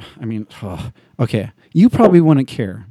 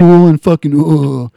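loud, lazy cool-guy grunt; mock cool guy; 3 semitones higher, 6 dB louder than usual. Friend mocking Johnny Depp's cool pose, loud cool-guy grunt. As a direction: act too cool for it. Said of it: "he's acting all cool".

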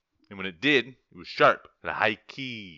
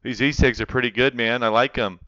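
loud dude voice; 2 semitones higher, 14 dB louder than usual. Pretend. Acted dude dialogue.

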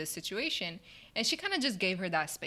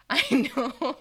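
laughing, louder and higher; loud laughing I know; 9 semitones higher, 7 dB louder than usual. Real, not acted. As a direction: laugh while admitting it. Laughing acknowledgment.

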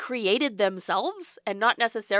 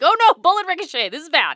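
mock panic; 8 semitones higher, 5 dB louder than usual. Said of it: "they're like".

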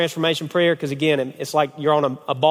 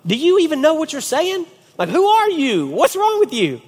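high-pitched, astonished squawk; hammed-up disbelief, voice shooting up; louder, much higher retold astonishment; mock incredulity; 12 semitones higher, 4 dB louder than usual. Acts out his astonished reaction.

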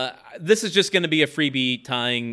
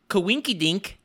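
coinky dink in a broad Wisconsin accent, pitched up; 6 semitones higher, slower than usual. Real, not acted. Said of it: "I just heard the Wisconsin accent".

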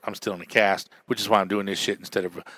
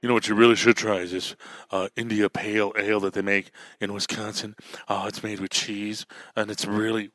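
lightly voiced craft-beer snob, softer; mocking beer snob; 4 dB quieter than usual. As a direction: tease a pretentious beer drinker. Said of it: "Don't come at me with".

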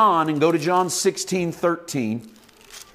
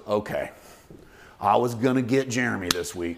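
a hint of a grumbling God; 6 semitones lower, 5 dB quieter than usual. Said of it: "And then God goes".

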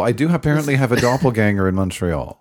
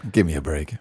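ordinary delivery

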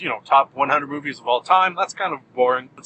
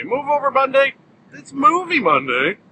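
pitched up, louder, cheering the show's theme; excited announcing; 9 semitones higher, 4 dB louder than usual. Acted. Host hypes listeners for Movie Monday, excited and pitched up. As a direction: sell it like a game-show host kicking off.

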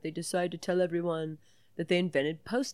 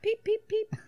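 high little bird peeps; peeping bird. Pretend. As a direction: peep like a tiny bird. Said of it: "that's a peep".

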